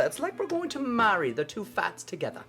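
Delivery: a lightly put-on British accent, chatty baking host; mock British cook, cheerful and hammed-up; British accent, light and jokey. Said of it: "why am I still talking like this".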